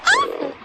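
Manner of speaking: sudden high-pitched yelp of fright; sharp scared cry at a tree; startled yelp. Real, not acted.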